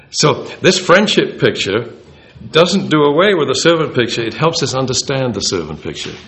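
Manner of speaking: usual speaking voice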